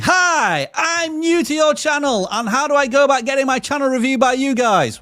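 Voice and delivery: high, loud reading of a shouted message; shouting. Retold or imitated. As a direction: read it like an all-caps yell. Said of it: "stop shouting".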